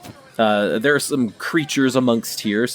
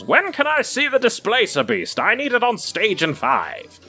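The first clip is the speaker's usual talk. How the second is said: much higher, hurried backstage call; busy stagehand; 12 semitones higher than usual. Acted. Circus worker calling out.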